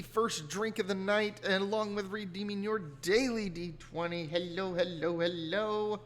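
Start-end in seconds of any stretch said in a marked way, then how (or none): none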